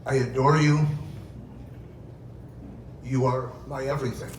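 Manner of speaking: ordinary talk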